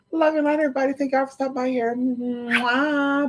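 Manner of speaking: high, soft drawn-out goodbye; high, soft, lingering farewell; sweet sign-off. Real, not acted. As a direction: blow a kiss and stretch the farewell out high. Affectionate sign-off with a kiss.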